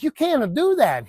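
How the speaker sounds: high, slow, stretched-out objection; mock protest. Owner seeing the dead animal on the nail, mock protest. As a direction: draw it out high in mock dismay.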